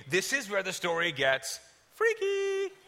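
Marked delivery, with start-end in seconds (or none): 1.9-2.7 high, held singsong; playful spooky squeal. Pretend. Speaker warning audience the story turns strange, playful spooky squeal. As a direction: tease the word out in a high squeal.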